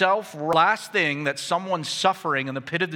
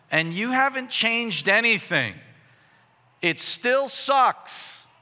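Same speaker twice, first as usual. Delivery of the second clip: slow, high-pitched complaint to God; frustrated prayer; 8 semitones higher, 3 dB louder, slower than usual. Acted. Believer tells God nothing has changed after long prayer, frustrated. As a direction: drag out each grievance, building higher to the end. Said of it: "the kind of thought process".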